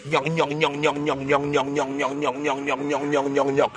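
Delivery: low, soft, monotone "gnon gnon" babble; mock gibberish chatter. Vlogger mimicking new arrivals at the airport, mocking low babble. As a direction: imitate prattle in a low, quiet, even stream. Imitates newcomers' put-on accent chatter.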